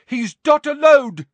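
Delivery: high, loud call across a room; shouted from above. A narrator's reading. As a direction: shout it down to them. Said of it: "shouted a voice from above them".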